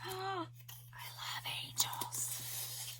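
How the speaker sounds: breathless, awed whisper after a gasp. Real, not acted. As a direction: whisper it, overwhelmed by the gift. Gasping, whispering over gift.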